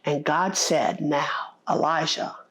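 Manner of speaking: loud, driving sermon delivery; full-force preaching. Real, not acted. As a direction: preach it out to the congregation.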